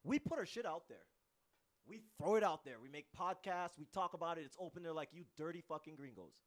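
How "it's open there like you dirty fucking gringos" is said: usual speaking voice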